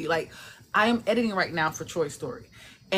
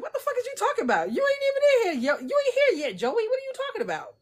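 squeaky high, fast disbelief; shrieking at the character in mock outrage; high-pitched what-are-you-on; incredulous. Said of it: "I'm like".

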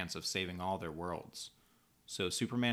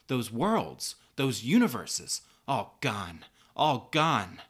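high, loud accusation easing into anguished grief; outraged heroine; 7 semitones higher, 8 dB louder than usual. Acted. Supergirl confronts the villain over countless deaths, outraged and anguished. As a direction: hurl the accusation, then lament the dead.

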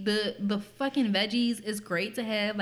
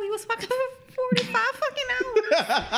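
high laughing put-on voice; high mock voice; 12 semitones higher than usual. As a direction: keep the squeaky, giggling storytelling voice.

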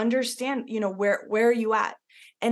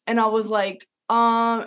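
ordinary talk, nothing marked

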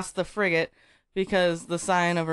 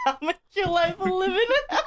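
laughing demon threat; 9 semitones higher than usual. Acted. Demon threat, reading story.

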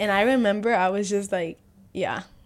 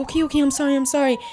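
mock placating, pitched up; hurried apology; 6 semitones higher, faster than usual. Ex apologizing when threatened with screaming, mock placating. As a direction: imitate someone hastily backing down. Acts ex hastily backing down.

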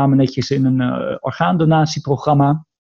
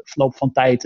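normal talking voice

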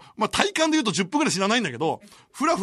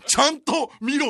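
slow, loud, higher scolding; angry order; 7 semitones higher, 5 dB louder, slower than usual. Retold or imitated. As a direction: snap at him to watch properly. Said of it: "めちゃくちゃキレられて". (怒った命令口調)

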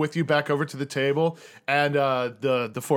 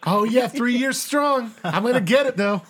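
eager pledge voice; 10 semitones higher, 5 dB louder than usual. Acted. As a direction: insist hopefully in a high voice. Dog Shit boasts of pledging.

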